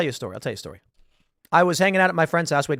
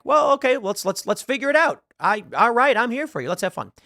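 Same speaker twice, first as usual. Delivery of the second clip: higher-pitched, easygoing go-along voice; acted agreeable parent, pitched up; humouring a child; 7 semitones higher than usual. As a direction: play the dad gamely going along with it. Dad humours his child's announcement.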